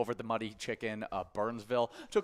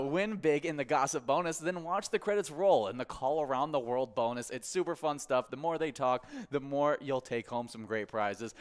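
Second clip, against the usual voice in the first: higher, peppy sponsor read, slowing on the last line; upbeat ad read; 3 semitones higher than usual. Acted. Host reading a sponsor spot for the Phone Tag game, upbeat ad voice. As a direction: sell the game like a radio spot.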